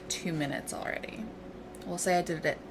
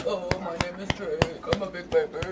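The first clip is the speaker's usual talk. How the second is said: lightly lowered pet voice; voicing the dog. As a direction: speak for the dog, a touch goofy. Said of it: "Say hi".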